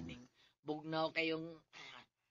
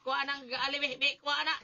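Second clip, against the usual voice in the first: higher strained grunts and calls for help; straining groans; 8 semitones higher, 7 dB louder than usual. Acted. Calling Pitra for help under heavy tools, straining. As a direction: groan and call out as the load slips.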